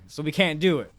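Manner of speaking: ordinary talk, nothing marked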